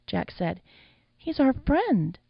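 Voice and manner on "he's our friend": high, drawn-out plaintive appeal; pleading. A narrator's reading. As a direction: stretch it out, pleading high and slow. Jack pleading for dead friend.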